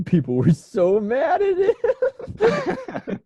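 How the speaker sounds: slow, wheezing laughter over the words; laughing hard. Real, not acted. Host laughing that people were mad at [name]. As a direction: barely get it out through laughter.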